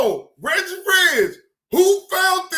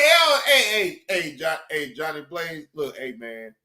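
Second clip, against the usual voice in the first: much lower, pleading imitation; imitating Cam Lo; 11 semitones lower than usual. Imitating Cam Lo pleading with Johnny Blaze. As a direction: plead low like Cam Lo begging. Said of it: "He said".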